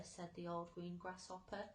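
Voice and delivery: ordinary delivery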